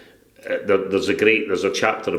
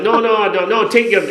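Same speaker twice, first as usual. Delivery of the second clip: high, loud, laughing no no; giggling mock refusal; laughing protest; 12 semitones higher, 4 dB louder than usual.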